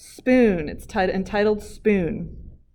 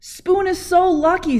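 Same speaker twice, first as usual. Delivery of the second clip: envious Chopsticks; 10 semitones higher, 6 dB louder than usual. Acted. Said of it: "said Chopsticks".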